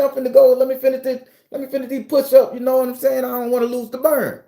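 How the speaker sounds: high-pitched retold protest; pleading excuses. Exerciser begs to finish push-ups, high pleading excuses.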